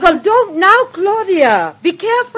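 high, urgent caution; anxious mother's high-pitched warning; alarmed warning. Acted. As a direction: warn her sharply before it's too late. Mother warns as daughter lights gas.

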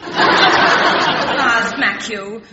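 slow, loud laughing threat. Acted.

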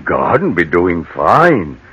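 lightly voiced West Country yokel; a friendly menace behind the country charm; country gardener chatting to the old lady; rustic gardener.

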